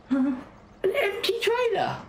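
high, slow, sneering mimicry; mock it in a silly high squeak. Mocking the other driver.

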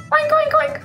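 squeaky high oinking; high pig oinks. Pretend. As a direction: oink like a little piggy. Pig sounds for kids.

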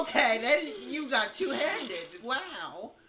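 high, loud, drawn-out amazement; high loud slow exclamation. Real, not acted. Streamer amazed by the killer, high drawn-out shout. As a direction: stretch it out in loud awe.

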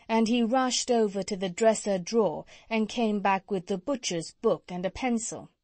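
ordinary delivery, nothing marked

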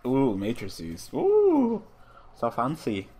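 sarcastic high ooh, mock impressed; mock awe. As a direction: fake wonder at matrices. Mocking algebra topic.

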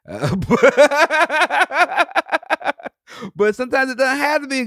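high, loud laugh breaking in. Real, not acted. Comedian laughing that the movie was bad, loud laugh. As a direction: burst out laughing.

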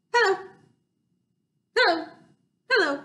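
quick clipped hellos in a row; fast hellos. Shown as a demonstration. Host says hello as fast as she can. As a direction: fire them off fast. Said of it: "as fast as we can".

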